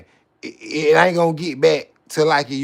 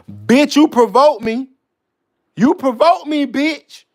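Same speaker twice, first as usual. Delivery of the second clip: high, loud and slow, spitting it out; furious slow holler at the one who provoked him; angry yell; 7 semitones higher, 7 dB louder than usual. Acted. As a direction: yell the accusation, dragging it. Rant peaks at the provoker.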